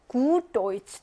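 "Gut" with a drawn-out u; long vowel. Shown as a demonstration. Teacher models a stressed long vowel for students, long "Gut". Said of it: "길게".

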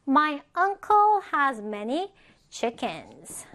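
slow, carefully spaced English example; slow model reading. Shown as a demonstration. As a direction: read it slowly for learners to follow. Teacher models example sentence for learners.

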